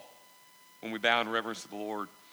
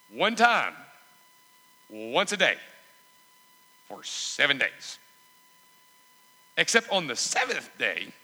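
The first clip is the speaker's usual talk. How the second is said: louder, punchy instructions with pauses between phrases; emphatic preached orders; 5 semitones higher, 6 dB louder than usual. Acted. Preacher voicing the Lord's instructions to Joshua, emphatic preaching. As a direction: lay out the marching orders forcefully, phrase by phrase.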